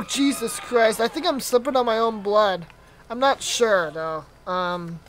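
usual speaking voice

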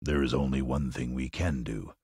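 low lion voice; lightly voiced Lion. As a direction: answer low, as a storybook lion. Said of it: "returned the Lion".

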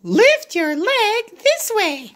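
high little pig voice; Piggy showing Gerald the leg move, high voice. Acted. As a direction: pitch way up, a little pig teaching.

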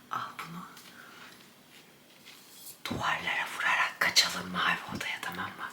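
breathy hushed whisper; whispering while hiding. Real, not acted. Plotting while hiding from dad. (saklanırken fısıltı)

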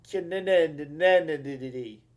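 drawn-out, stammered syllables for fun; playful stretched name. Real, not acted. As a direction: stutter the name out slowly.

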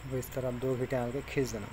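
ordinary talk

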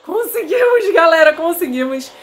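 high, loud joyful cheer; triumphant. Real, not acted. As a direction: celebrate the escape. Escaped, celebrating.

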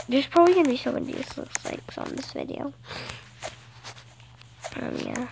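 ordinary talk, nothing marked

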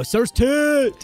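high, celebratory shout; excited cheer. Real, not acted. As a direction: whoop the name like a fan. Cheers creature levelling up.

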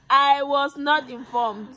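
mock-indignant protest. Real, not acted. Mother telling viewers about forced labor, mock-indignant. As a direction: draw it out high in playful protest.

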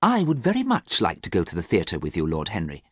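ordinary delivery, nothing marked

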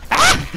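sudden high, loud scream of fright; jump-scare yelp, way up high; shriek. Real, not acted. Gamer caught off guard, startled shriek.

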